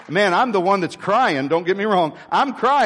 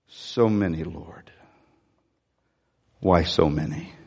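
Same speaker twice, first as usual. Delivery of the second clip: soft, low, sorrowful sigh to God; quiet lament; 12 semitones lower, 5 dB quieter than usual. Retold or imitated. Pastor lying in bed, sighing to God, soft and low. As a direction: murmur it like a weary prayer in bed. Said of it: "she hears me say".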